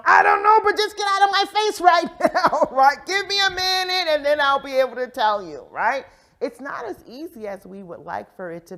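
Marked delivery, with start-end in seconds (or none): 0.0-2.7 high, loud exasperated yell; frantic, high-pitched shout; overwhelmed outburst. Acted. Acting out an overwhelmed moment. 3.0-5.7 high, loud exasperated plea; worked-up, raised-voice retort; overwhelmed outburst. Acted. Acting out an overwhelmed moment.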